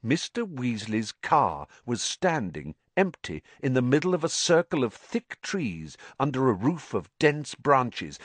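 plain reading voice

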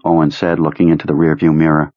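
plain reading voice

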